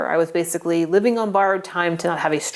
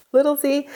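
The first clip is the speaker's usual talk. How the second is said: "Little c" lifted high, playful; little c again; 8 semitones higher than usual. Shown as a demonstration. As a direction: sing out "Little c". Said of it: "cancer with a little c".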